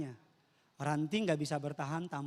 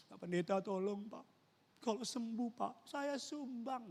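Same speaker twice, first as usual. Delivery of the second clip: soft, high, drawn-out plea; feeble begging patient; 6 semitones higher, 4 dB quieter than usual. Retold or imitated. Hospital patient begging the pastor for healing, soft feeble plea. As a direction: plead softly like a failing patient.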